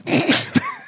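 real high-pitched burst of laughter; laugh out loud. Real, not acted. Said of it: "did I laugh out loud at you?".

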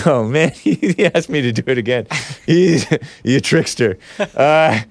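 ordinary talk

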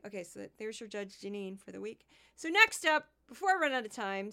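ordinary delivery

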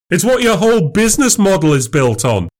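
high, insistent retort; heated accusation. Acted. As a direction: press the charge against her. Husband presses accusation.

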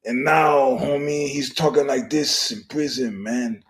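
mock Mexican accent, loud at the start; fake Mexican accent. Mocking a skinhead's new prison persona, fake Mexican accent. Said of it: "he's talking in a fake Mexican accent".

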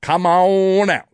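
loud, high, long-drawn botched Kentucky accent; exaggerated replay of a bad Kentucky accent; mock Australian-Southern accent. Shown as a demonstration. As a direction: stretch "on" way out, halfway between Australian and Southern. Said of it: "Australian and Southern".

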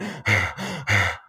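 heavy open-mouthed panting, imitating runners; runners' mouth breathing. Imitating people out running with dysfunctional breathing, huffed mouth breaths. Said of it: "people out there running going".

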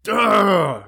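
a loud hammed-up frustrated ugh; mock angry groan. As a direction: groan in fake rage. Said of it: "I'm so mad".

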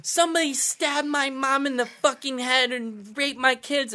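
high, slow overblown outrage; mock dramatic. Mocking YouTubers with fake drama.

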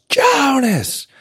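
high called name, falling off; yelling a name. Retold or imitated. Mom calling her son from the kitchen, high call. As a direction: call him down from upstairs. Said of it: "she yells".

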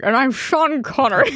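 put-on Scottish-tinged Russian accent, laughing; Sean Connery impression. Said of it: "In my Russian accent".

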